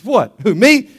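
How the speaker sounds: acted puzzlement, pitch jumping up; startled customer. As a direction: react as the man singled out. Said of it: "He says".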